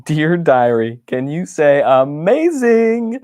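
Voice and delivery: gleeful, drawn-out amazing; excited. Acted. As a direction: sell the wonder to the diary. Excited diary entry.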